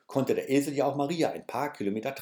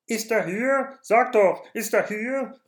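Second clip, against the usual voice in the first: hoch und laut, drängend gerufen; laut rufend; 9 semitones higher, 6 dB louder, slower than usual. A narrator's reading. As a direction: aufgeregte Hirten rufen an der Tür. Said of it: "riefen sie laut durcheinander". (lautes, drängendes Rufen)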